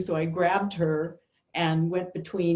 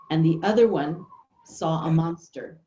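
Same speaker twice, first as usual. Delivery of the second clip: ordinary talk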